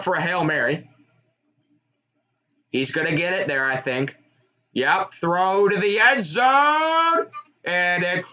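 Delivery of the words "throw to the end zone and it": mounting excitement. Real, not acted. Fan calling a Hail Mary throw to the end zone, mounting excitement. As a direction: voice climbing, words stretched out.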